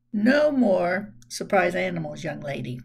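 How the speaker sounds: a mock-serious firm warning; firm fatherly voice. A narrator's reading. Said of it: "in his firmest, I'm really not kidding this time voice".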